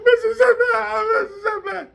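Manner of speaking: high, loud, hammed-up wailing cry; mock victim wailing for the guard; crying for help. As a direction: wail the name like a desperate victim. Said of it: "he's talking about".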